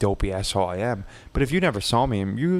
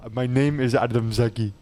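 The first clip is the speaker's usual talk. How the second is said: put-on Indian accent introduction; playful Indian-accented greeting; 3 semitones higher than usual. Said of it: "I just talk in an Indian accent".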